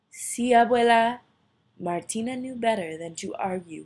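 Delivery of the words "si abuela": called answer. Acted. Answering the grandmother.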